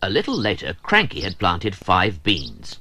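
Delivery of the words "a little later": ordinary delivery, nothing marked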